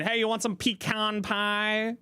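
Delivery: a hammed-up Southern grandma offering pie; old Southern lady. Teasing chat by mocking an old Southern lady's accent. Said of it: "That was not a really Southern accent".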